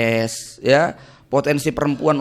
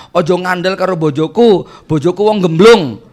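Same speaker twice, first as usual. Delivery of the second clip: louder retold yell in Javanese; a wife yelling around the village, hammed up; mock shouting wife; 3 semitones higher, 6 dB louder than usual. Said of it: "istrinya teriak-teriak". (teriakan istri yang ditirukan)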